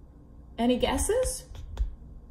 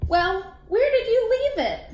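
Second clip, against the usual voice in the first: character voice; 9 semitones higher, 4 dB louder than usual. Acted. Voicing a sea creature.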